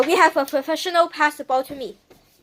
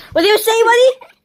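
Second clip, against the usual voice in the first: loud high mock bully taunt; belligerent drunk; 8 semitones higher, 8 dB louder than usual. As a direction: swagger and jeer, loud. Tough-guy taunt at brother.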